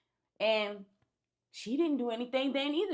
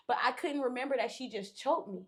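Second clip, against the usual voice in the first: normal talking voice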